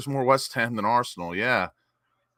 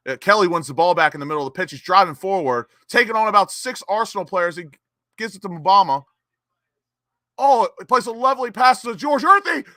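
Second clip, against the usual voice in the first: fast, higher, louder running commentary; live play-by-play; 9 semitones higher, 7 dB louder than usual. Real, not acted. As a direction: call the attack as it unfolds.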